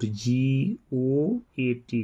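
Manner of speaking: very slow, letter by letter; dictating to the class at a crawl; slow dictation. Shown as a demonstration. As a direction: spell it out slowly for pupils to copy. Teacher dictating spelling slowly.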